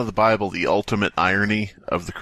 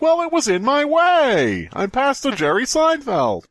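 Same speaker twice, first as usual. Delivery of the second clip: high, slow, hammed-up preacher voice; pompous Pastor Jerry; 3 dB louder, slower than usual. Friend voicing a pompous pastor, high and slow. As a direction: drag it out, very high and self-important. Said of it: "And he's like".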